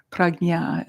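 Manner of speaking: prajna with a hard g, "prag-nya". Shown as a demonstration. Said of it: "that's like a G".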